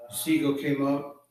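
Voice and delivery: ordinary delivery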